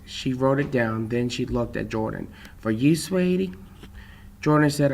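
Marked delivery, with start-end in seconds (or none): 2.6-3.5 lightly voiced waitress, higher pitch; a hint of a sweet-talking waitress, pitched up. A narrator's reading. Waitress voiced addressing a customer.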